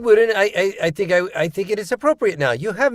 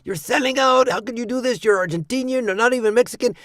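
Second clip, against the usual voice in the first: higher-pitched mock accusation; retold threat, voice pitched up; imitated accusing caller; 7 semitones higher than usual. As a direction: imitate an indignant caller scolding a sellout. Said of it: "got calls and threats like".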